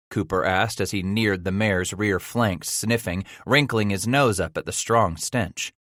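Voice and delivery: ordinary delivery, nothing marked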